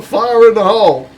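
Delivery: big bellowing yell of fire in the hole; shouted warning. Retold or imitated. Friend shouting a warning from behind the door, loud yell. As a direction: bark the warning as the firework flies. Friend throws homemade firework, takes cover.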